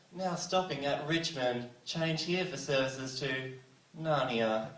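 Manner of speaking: slow, measured station announcement; train announcer. Retold or imitated. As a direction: read it like the recorded train voice. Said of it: "you just hear".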